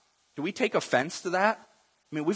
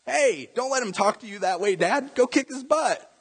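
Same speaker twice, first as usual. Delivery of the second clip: put-on high, excited kid voice; acted indignant kid, pitched right up; kid egging Dad on; 9 semitones higher than usual. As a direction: goad Dad into a fight like a child. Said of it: "picture your kid coming up doing that".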